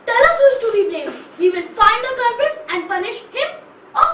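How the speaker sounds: high loud rallying call; battle cry. Acted. Writer rousing the town to fight, loud rally. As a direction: rouse them to fight back.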